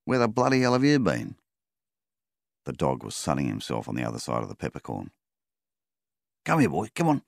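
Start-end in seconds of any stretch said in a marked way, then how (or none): 0.0-1.4 calling out. Acted. Calling the dog from afar. 6.4-7.2 high, loud call across the yard; man calling his dog from a distance. Acted.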